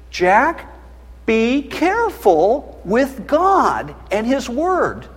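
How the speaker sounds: slow, weighty caution; slow solemn preacher's warning to Jack. Acted. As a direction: lay each word down slowly.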